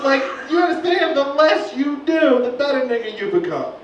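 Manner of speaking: ordinary talk, nothing marked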